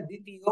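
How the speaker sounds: দ্বিতীয় with the ব left silent. Shown as a demonstration. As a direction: say দ্বিতীয় as "ditiyo", no b or w sound. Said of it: "বয়ের উচ্চারণ কিন্তু আমরা করতেছি না".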